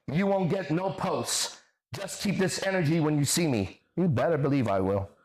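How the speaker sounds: mocking read-out. Streamer reading an opponent's threats back to viewers, mockingly.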